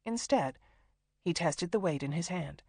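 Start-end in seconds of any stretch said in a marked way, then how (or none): none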